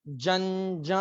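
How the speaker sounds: जंजा with the dot sound, rising at the end; model syllable. Shown as a demonstration. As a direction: teach जंजा as a question-like call. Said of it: "ऊपर बिंदी लगा देते हैं".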